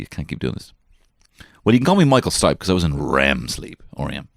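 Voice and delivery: ordinary talk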